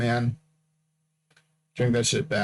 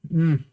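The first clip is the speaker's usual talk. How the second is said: a real audible yawn, rising; 5 semitones higher, 4 dB louder than usual. Real, not acted. Host yawning during the recording, audible yawn. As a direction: let a yawn slip out mid-show. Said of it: "That's my second yawn".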